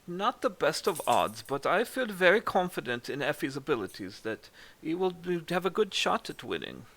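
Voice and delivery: put-on amateur German accent with polite enthusiasm. Game master as Reese discussing horse odds, German accent. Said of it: "My, my German accent sucks too".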